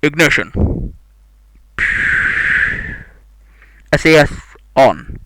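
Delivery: loud booming launch announcer voice; space announcer voice. Acted. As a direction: call the launch countdown like a big space announcer. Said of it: "space announcer voice".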